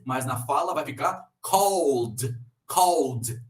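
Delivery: "called" with the L swallowed into an O sound, like "cold". Shown as a demonstration. Teacher showing students that "called" sounds like "cold". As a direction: draw out "cold" slowly, L turned to O. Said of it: "A L juntinhos vai ter som de O".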